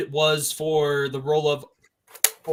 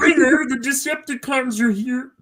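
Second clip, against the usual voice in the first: high laughing Kermit voice; giggly frog puppet impression; 9 semitones higher than usual. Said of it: "Kermit the Frog impression".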